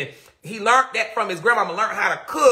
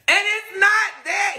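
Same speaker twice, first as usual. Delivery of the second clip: insistent outburst; 10 semitones higher than usual. Real, not acted. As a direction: push back hard on the misunderstanding. Insisting defending her father.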